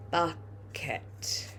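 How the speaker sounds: softly whispered while writing; teacher whispering the word to herself. Shown as a demonstration. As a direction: breathe the word out as you write it.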